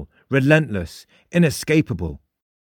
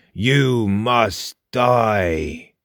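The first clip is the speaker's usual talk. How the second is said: very slow, drawn-out ghostly intoning; chilling calm ghost; much slower than usual. A narrator's reading. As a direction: pronounce doom calmly, each word stretched out. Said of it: "repeated the ghost".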